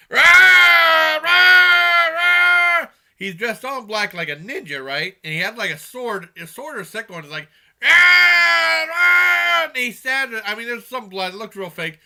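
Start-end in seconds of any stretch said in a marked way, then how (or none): 0.1-2.9 long, high, very loud monster cry; imitated movie-monster scream; Chooper's roar. Shown as a demonstration. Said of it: "this is how the Chooper sounds". 7.8-9.7 high, very loud monster scream; two long shouted roars; Chooper's roar. Retold or imitated. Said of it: "this is how the Chooper sounds".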